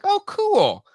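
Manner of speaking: recalling an excited "oh, cool" in a high voice; retold excitement. Host recalling first reaction to the toy announcement, excited. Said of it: "I was kind of excited".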